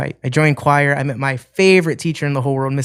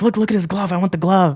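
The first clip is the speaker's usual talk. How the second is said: put-on eager little kid; 5 semitones higher than usual. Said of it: "Or like".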